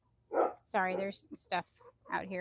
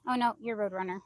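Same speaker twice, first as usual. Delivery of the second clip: normal talking voice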